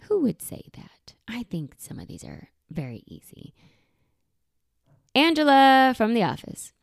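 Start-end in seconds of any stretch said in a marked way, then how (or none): 0.1-3.5 hushed whisper, fading away; a quick confidential whisper; whispered aside. Real, not acted. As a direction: say it under your breath.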